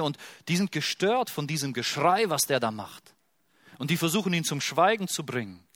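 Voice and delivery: usual speaking voice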